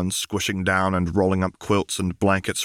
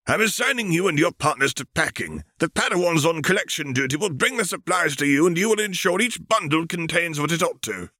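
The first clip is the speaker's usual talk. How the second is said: put-on high, airy voice of an old alien master; alien Jedi master; 10 semitones higher than usual. Thespiasian master briefing Obi-Wan, in a high, stately master voice. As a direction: speak up high like a serene ancient teacher. Master assigns packing duty.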